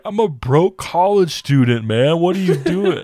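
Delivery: high, loud mock protest; whiny protest. Friend protesting to the narrator over the bill, high loud whine. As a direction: squeal it like a broke student wronged.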